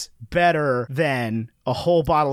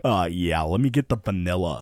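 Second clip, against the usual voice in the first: much lower, dopey-sounding order; mock boring customer; 11 semitones lower than usual. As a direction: drop way down to imitate a dull customer. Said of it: "and you're like".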